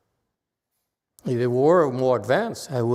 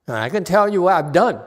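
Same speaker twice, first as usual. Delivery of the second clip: raised, eager voice of a confident monk; higher, louder, quick self-assured reply; voicing Yang Shen; 6 semitones higher, 5 dB louder than usual. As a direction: play the sure disciple, pitched up. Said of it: "Yang Shen says".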